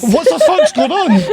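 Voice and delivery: high, loud mock disbelief; exaggerated shout of confusion; bewildered outburst. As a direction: cry out in laughing bafflement. Viewers' bewildered reaction acted out.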